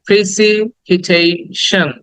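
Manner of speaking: very slow "precipitation", pri-si-pi-tei-shan; repeat-after-me syllables. Shown as a demonstration. Teacher has class say it with him, slow syllables. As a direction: draw out each syllable of "precipitation". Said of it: "আবার বলো".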